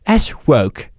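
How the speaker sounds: put-on British "woke"; mock British accent. Shown as a demonstration. Host showing how woke sounds, mock British accent. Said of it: "say woke in a British accent".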